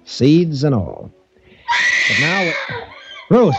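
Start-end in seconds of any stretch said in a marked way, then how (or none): 2.1-3.5 alarmed call. Acted. Hearing a scream, calling.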